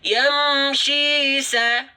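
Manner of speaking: yamshi sa drawn out slowly; slow recitation. Shown as a demonstration. Teacher models recitation for viewers, slow. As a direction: recite yamshi sa with two-beat lengthening.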